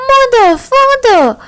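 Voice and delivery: put-on very high child's shriek; highest-pitched child shriek. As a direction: scream for his parents at the top of his voice. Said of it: "shrieked at the highest pitch of his voice".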